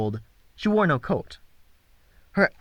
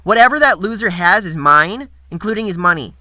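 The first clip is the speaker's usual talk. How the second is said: loud, bossy, nasal; bossy nasal girl; 5 semitones higher, 7 dB louder than usual. Acted. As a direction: claim it like a playground boss. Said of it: "Her bossy, nasal voice".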